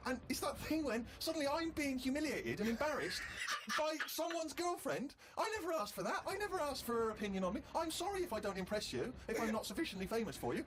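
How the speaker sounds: high-pitched comic indignation; indignant rant. Retold or imitated. Comic humiliated by a fan's girlfriend, retold in high indignation. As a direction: retell the humiliation with rising, exasperated outrage. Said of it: "by now I was just furious".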